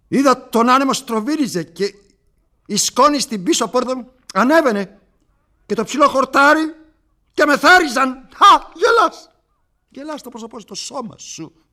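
very high and loud, swept away; frenzied rapture. Acted. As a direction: let the wind carry you into a scream. Frenzied vision of wind. (ξέφρενη έκσταση)

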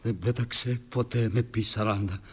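whispered low, no voice; hushed denial. Acted. Father to his son, a whispered denial. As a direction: deny it under your breath.

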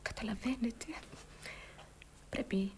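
low, very soft, halting plea; hushed sorrow. Acted. Mourning woman begs the man to stop pitying her, hushed. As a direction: wave off the pity almost in a whisper. Acted grief, woman declines the sympathy.

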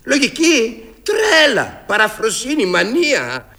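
loud, high cry of helpless passion; passionate outburst. Acted. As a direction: burst out, voice breaking with longing. Ξεσπά για τον έρωτά του. (παθιασμένο ξέσπασμα, δυνατά και ψηλά)

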